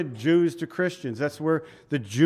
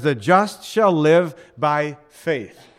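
The just shall live by faith, said slowly with weight; slow emphatic quote; 6 dB louder, slower than usual. Shown as a demonstration. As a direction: read the verse slowly and firmly. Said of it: "It says".

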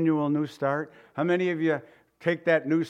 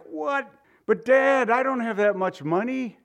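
voice breaking; 7 semitones higher than usual. Pretend. Son pleading with his judge father, near tears. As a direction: beg your father with a cracking voice. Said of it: "Your voice breaks and you're ready to cry".

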